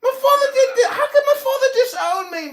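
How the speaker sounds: high, loud incredulous laugh; laughing disbelief; laughing outrage. Real, not acted. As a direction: scoff at the very idea.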